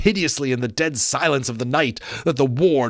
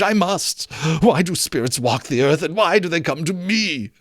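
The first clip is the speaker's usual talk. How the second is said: higher pleading questions; frightened Scrooge; 6 semitones higher than usual. Acted. Scrooge questioning the ghost, higher anxious plea. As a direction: ask it anxiously.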